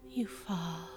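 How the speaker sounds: ordinary talk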